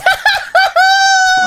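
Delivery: piercing high burst of laughter; loud laugh. Real, not acted. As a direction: explode into a loud, high cackle. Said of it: "so loud in my ear".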